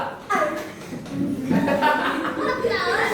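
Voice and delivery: a short amused laugh; light laugh. Real, not acted. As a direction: laugh along at the student's face. Class laughs at expression demo. (tawa kecil geli)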